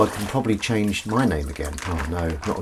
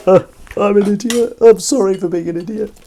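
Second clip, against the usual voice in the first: mock apology; 12 semitones higher, 7 dB louder than usual. Mock self-deprecating apology.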